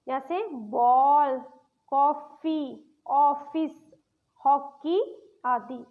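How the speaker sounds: बॉल, कॉफी with an open ऑ, slow; ऑ sound demo. Shown as a demonstration. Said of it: "इसको हमने ओ पढ़ना है". (ऑ की ध्वनि धीरे-धीरे खींचकर)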